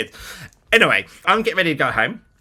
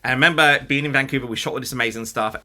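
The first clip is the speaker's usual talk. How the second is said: usual speaking voice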